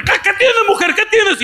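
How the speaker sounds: pitched high in alarm; alarmed husband. Retold or imitated. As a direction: ask fast, startled and worried. Esposo alarmado al oír llanto. (esposo alarmado, voz aguda y asustada)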